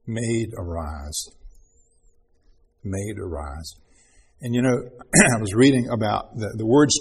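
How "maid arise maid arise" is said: low, slow and soft Maid, arise; quiet, tender, unhurried; soft tender call. Shown as a demonstration. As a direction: gently wake a sleeping child. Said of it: "and he said".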